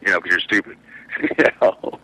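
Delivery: ordinary delivery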